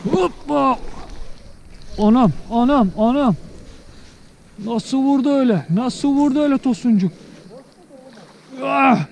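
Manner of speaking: excited shouting. Real, not acted. Angler cheers as a big fish hits his line, excited. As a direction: cheer the strike as it fights.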